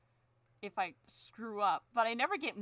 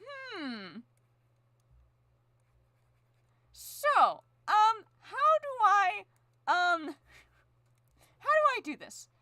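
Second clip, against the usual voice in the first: confused self; 10 semitones higher, 7 dB louder, slower than usual. Retold or imitated. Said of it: "and I'm like".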